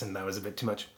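ordinary talk, nothing marked

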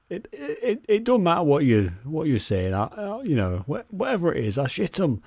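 a hint of a defiant Yorkshireman; Yorkshire attitude. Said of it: "just that general attitude of".

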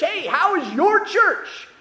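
a church member teasing the pastor about "his" church, cheerful and high; mock friendly greeting. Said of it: "act like you're the owner of the church".

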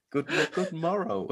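high hammy medieval greeting; larping voice. Retold or imitated. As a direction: play a larper hamming it up. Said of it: "Where they go and do the larping".

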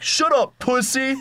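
shrill high mock yell of teasing buddies; mocking friends. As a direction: scoff at him like rowdy hiking friends. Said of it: "And they'd be like".

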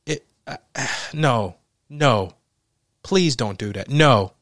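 ordinary talk, nothing marked

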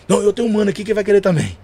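eager caller. Retold or imitated. Eager client on the phone.

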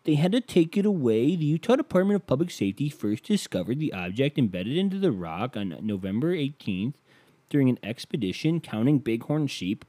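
a hint of a whiny know-it-all; mock people quote. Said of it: "people are like".

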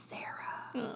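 tiny voiced whisper; meek whisper. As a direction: say it timid and barely audible. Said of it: "That is meek".

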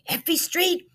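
high, startled yell; a high startled shout of the street name. A narrator's reading. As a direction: yelp it in disbelief. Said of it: "Don't yell, Bella".

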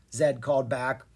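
ordinary delivery

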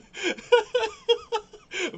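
loud, high cackling laugh; loud high laughter. Real, not acted. Laughing at own mix-up.

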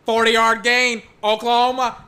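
mock radio play-by-play; play-by-play call. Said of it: "Now I'm just casting football games".

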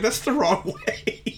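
high, slow, laughing protest. Retold or imitated. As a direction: laugh through the correction. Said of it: "I'm like".

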